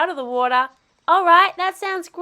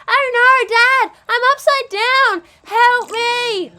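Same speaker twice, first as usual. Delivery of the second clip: playful panic; 7 semitones higher, 6 dB louder, slower than usual. Acted. Kid upside down in water.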